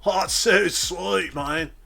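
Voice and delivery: high, loud surfer-stoner drawl. Acted. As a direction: play a spaced-out stoner dude. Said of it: "enough of the stoner voice".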